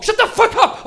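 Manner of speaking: loud, high snap; snapping. Retold or imitated. Panicked friend snapping at the non-smoker, loud and high. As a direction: bark at the friend.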